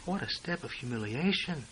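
normal talking voice

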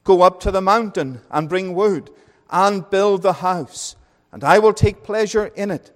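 high, loud preacher's reading, slowing on key phrases; solemn King James reading voice building in force; fervent scripture reading. Acted. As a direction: proclaim the text from the pulpit like the word of God itself. Reads scripture aloud, preaching.

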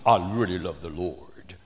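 a hint of fake solemn piety; mock deep pious voice. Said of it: "you guys lower your voice".

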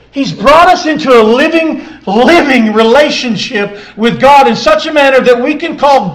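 loud, high, impassioned sermon delivery; full-force preaching. Real, not acted.

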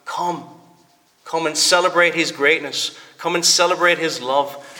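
ordinary delivery

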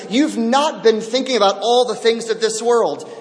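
full-voiced sermon climax; fervent preaching. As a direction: preach it up with growing fire.

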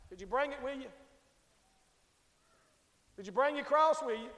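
normal talking voice

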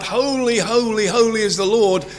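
angels crying out. Acted. Angels crying out to God in Isaiah's vision, lightly proclaimed. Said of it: "he hears the angels crying out".

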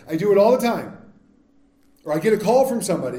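ordinary talk, nothing marked

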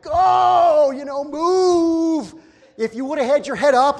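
very high, loud, drawn-out yell; hammed-up yell at a stalled driver; frustrated shout. Preacher yelling at a driver staring at a phone, loud. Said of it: "and I'm like".

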